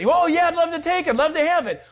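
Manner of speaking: eager yes. Acted. Brother offered the relative's land, eager loud laughing yes. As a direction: jump at the deal, greedy and delighted.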